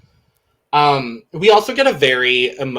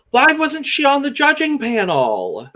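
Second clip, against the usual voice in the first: much higher, slow and gushing; 12 semitones higher than usual. Retold or imitated. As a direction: swoon over her. Gushing over a contestant.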